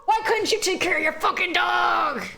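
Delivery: high furious yell at the screen; angry shout. Real, not acted. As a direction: scold the witch at full voice. Yelling at the witch character.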